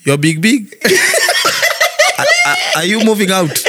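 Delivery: laughing mock English. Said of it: "umejibeba kwani unahama".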